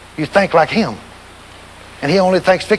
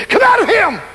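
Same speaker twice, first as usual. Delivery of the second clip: commanding shout; 11 semitones higher than usual. Retold or imitated. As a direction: bark the order at the demon. Climax of retold exorcism command.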